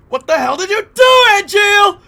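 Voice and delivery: very high, booming melodramatic yell; hammed-up shout. Streamer mocking a game line for chat, overblown shout. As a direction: bellow it at full pitch, over the top.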